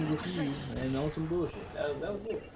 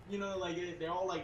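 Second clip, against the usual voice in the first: ordinary talk, nothing marked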